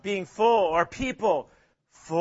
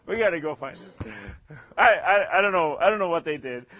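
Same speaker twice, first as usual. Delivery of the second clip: ordinary talk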